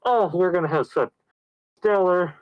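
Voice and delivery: high-pitched imitation of the woman; hammed-up happy wife; mock delighted wife. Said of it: "the woman's like".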